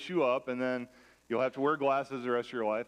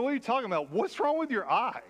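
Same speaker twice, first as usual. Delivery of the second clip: incredulous retort; 7 semitones higher, faster than usual. Retold or imitated. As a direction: pitch jumps up, mock disbelief. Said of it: "I'm like".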